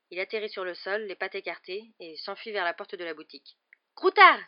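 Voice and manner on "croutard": high, very loud call; alarmed exclamation. A narrator's reading. Boy chasing his escaped rat, urgent shout. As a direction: yell after a runaway pet. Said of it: "s'exclama Ron en se lançant".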